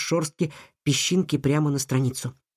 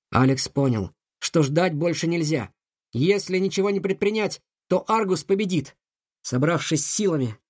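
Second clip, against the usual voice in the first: ordinary delivery, nothing marked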